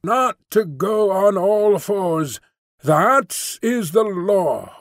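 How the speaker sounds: drawn-out creature sing-song. A narrator's reading. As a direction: intone it like a mad litany. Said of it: "in a kind of sing-song".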